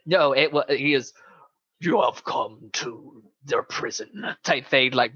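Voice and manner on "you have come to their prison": slow whispered scary Igor voice; a hint of a menacing old master; deep scary voice. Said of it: "really deep".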